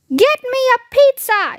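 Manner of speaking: loud, high bratty shout; full high-pitched tantrum yell. A narrator's reading. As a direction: yell it like a spoiled child making demands. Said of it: "yelled Ivy".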